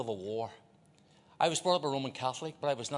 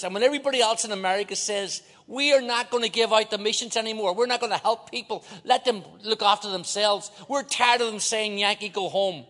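preaching shout; 7 semitones higher, 9 dB louder than usual. Real, not acted. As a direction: thunder it out from the pulpit. Preacher at full force, quoting.

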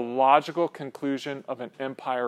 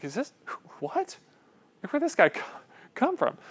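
high squeaky baffled what; stunned churchgoer, squeaking in disbelief; 9 semitones higher, 5 dB quieter than usual. Pretend. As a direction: pitch it way up in disbelief.

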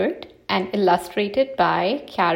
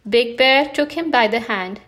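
ordinary delivery

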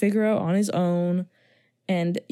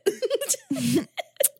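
bright laugh; 13 semitones higher than usual. Real, not acted. Laughing at the movie's red flags.

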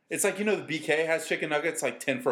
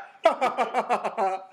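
high, staccato mock laugh; imitated mom laugh; 7 semitones higher, 3 dB louder than usual. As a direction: do a high put-on laugh in bursts. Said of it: "What's her laugh?".

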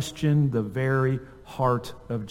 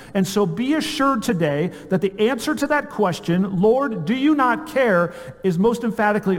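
higher-pitched, emphatic sermon delivery; impassioned preaching; 5 semitones higher than usual. Real, not acted. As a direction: preach it with mounting conviction, leaning on key words. Preaches at full force.

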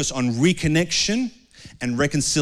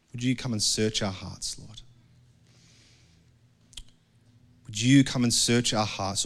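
closing prayer; 4 semitones lower than usual. Acted. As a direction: pray gently over the congregation.

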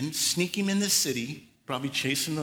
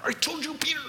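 gritted-teeth scolding; 13 semitones higher, faster than usual. Pretend. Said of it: "gritting his teeth at Peter".

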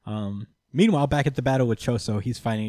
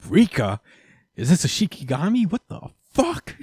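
higher, baffled exclamation; startled Itadori in much higher disbelief; confused shock; 6 semitones higher, 4 dB louder than usual. Retold or imitated. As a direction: react in disbelief. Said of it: "He's like".

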